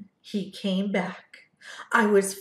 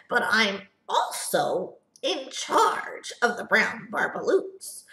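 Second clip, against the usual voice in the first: higher, snappish Lorax voice; Lorax snapping; 5 semitones higher than usual. Acted. Lorax scolding the Onceler about the Barbaloots, snappish and high. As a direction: scold like the Lorax guarding his trees. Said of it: "He snapped".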